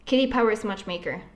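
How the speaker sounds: name rattled off quickly; too fast. Real, not acted. Naming a favorite game to the cohost, too fast. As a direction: run the words together quickly. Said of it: "You said it too fast".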